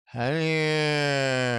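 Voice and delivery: long, lazily held "Honey"; stretched, lazy "Honey"; lazy drawl. Shown as a demonstration. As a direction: let "Honey" trail into a long slurred vowel. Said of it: "It can even get lazier".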